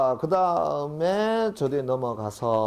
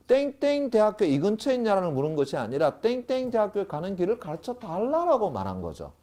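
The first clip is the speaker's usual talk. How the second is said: usual speaking voice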